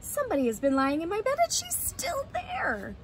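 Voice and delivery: little bear cub rising very high at the end; Baby Bear. Acted. Baby Bear finds Goldilocks in bed, high excited cry. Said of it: "cried Baby Bear".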